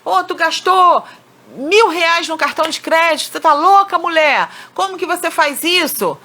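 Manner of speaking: high, sharp scolding of the wife over the card bill; angry complaint. Shown as a demonstration. Husband scolding his wife over the card, angry and high. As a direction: scold her like a furious spouse. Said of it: "você chegar e falar assim".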